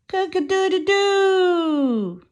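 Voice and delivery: high, slow rooster crowing; stretched-out rooster call. Pretend. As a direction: crow like a proud rooster greeting dawn. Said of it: "crowing loud to start the day".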